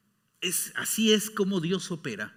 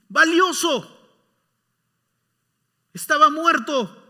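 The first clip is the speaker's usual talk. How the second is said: loud, much higher, impassioned plea; pleading father; 10 semitones higher, 9 dB louder than usual. Acted. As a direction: make him see his brother's worth. Father pleads about lost brother. (padre suplicante, fuerte y agudo)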